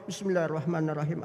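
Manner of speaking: rapid, low run-through; mock someone rattling off memorised prayer; hurried autopilot recitation; rote recitation. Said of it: "Karena hafal, jalan dia".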